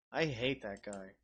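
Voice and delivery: usual speaking voice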